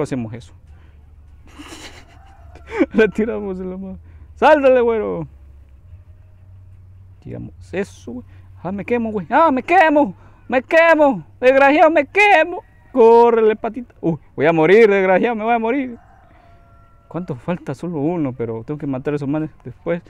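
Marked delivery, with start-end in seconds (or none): 4.3-5.3 loud, higher urgent command; shouting. Real, not acted. Gamer shouting at the blond guy to jump. Shouting order at game character. 8.6-16.0 high, loud panicked yells; panicked screaming. Real, not acted. Gamer screaming that he's burning and going to die.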